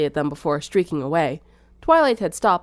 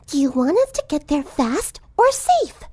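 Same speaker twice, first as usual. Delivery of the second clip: shy driver defending herself; lightly voiced gentle girl; timid Fluttershy; 8 semitones higher than usual. A narrator's reading.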